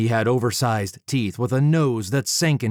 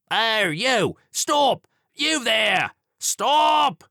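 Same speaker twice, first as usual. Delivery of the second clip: high shouted Stop from down the street; bobby calling out; 13 semitones higher, much slower than usual. A narrator's reading. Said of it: "a member of the constabulary stared".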